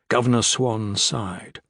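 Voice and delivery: ordinary delivery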